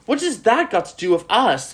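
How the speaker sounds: high put-on voice, brushing it off; acted dismissive character. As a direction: pitch it up as a stage heroine waving it away. Acts Sally's dismissive quote.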